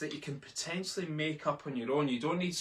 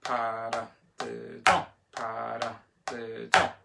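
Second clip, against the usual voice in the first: paradiddle chanted slowly, last syllable punched; slow chant landing hard on the end of paradiddle; accent on fourth syllable; much slower than usual. Shown as a demonstration. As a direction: hit the final syllable of each paradiddle. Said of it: "let's try paradiddle on four".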